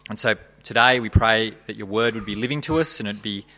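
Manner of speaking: soft, quiet prayer voice; hushed reverent opening prayer. Real, not acted. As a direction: pray humbly and gently.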